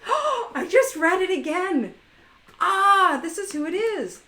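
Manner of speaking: realizing the guest's real name; sudden realization. Real, not acted. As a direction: gasp then high, loud discovery.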